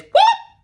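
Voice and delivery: very high, loud whoop; sharp whoop. Retold or imitated. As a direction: yelp it as you jump between fighters. Brother jumps in to separate them.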